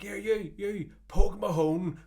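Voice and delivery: mock taunt. Bouncer jeering as they walk away, drawn-out taunt. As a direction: stretch the taunt like a jeer. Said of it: "He's like".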